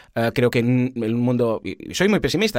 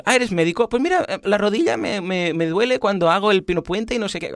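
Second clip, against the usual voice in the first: high, eager voice; eager chatty stranger; 8 semitones higher than usual. Pretend. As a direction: pester him brightly, pitched up. Stranger pesters doctor at meal.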